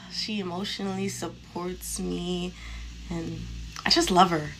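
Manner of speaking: soft, slow, lovesick sigh of a voice; imitating his sigh. Mocking the coworker's dreamy confession, soft and slow. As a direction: mimic him going all dreamy and quiet. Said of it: "he did it like this and everything".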